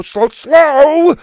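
panicked shout. Acted. Bo yelling at Buck to slow down, panicked high shout. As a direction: yell it as the dinosaur lurches on.

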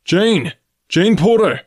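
high, strong, clear call; calling aloud. A narrator's reading. Man calls the girl through the burning forest, calling aloud. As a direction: shout the name out across a distance. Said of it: "her name being called aloud".

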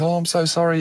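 high-pitched imitated apology; mock apology. Dad thinks he hurt his son, high apologetic voice. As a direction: imitate a flustered sorry, pitched well up.

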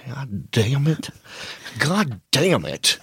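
slow, drawn-out Texan cursing; understated mock exasperation; mildly imitated annoyance. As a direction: grumble it slowly as a frustrated roommate. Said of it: "he got mad at the remote control".

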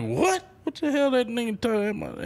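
high, fast, incredulous outburst; surprised. Retold or imitated. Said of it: "And I'm like".

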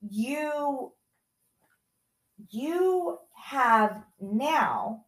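very slow, with long weighty pauses; grave, deliberate confrontation. Retold or imitated. Mother telling son of lost trust, slow and grave. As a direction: spell it out slowly, stopping between phrases.

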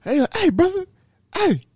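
high-pitched persistent caller; mock calling after someone. Imitates stranger calling after him.

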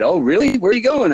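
high, rising surprised questions; mimicked surprise. Brother reacts to deployment news.

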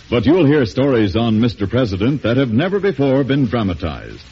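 ordinary talk, nothing marked

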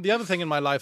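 ordinary talk

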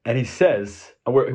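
usual speaking voice

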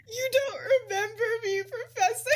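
high, loud, laughing fake wail; mock crying. Tearful girl to her forgetful professor, hammed-up crying. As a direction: imitate a tearful kid, cracking up. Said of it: "starts crying and she's like".

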